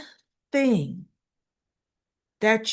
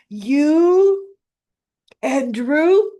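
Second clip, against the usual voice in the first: disgusted outburst; 6 semitones higher, 7 dB louder, much slower than usual. Retold or imitated. Retold quarrel, Willow disgusted.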